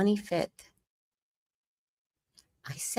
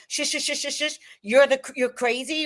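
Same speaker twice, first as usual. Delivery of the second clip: mock shushing husband; 8 semitones higher than usual. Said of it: "You think you get to tell me".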